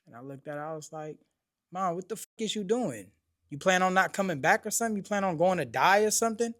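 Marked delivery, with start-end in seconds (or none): 1.7-6.5 raised, higher-pitched questioning of mom; incredulous retold challenge; alarmed confronting. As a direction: re-enact a son demanding answers, voice rising louder. Son alarmed, confronting his mother.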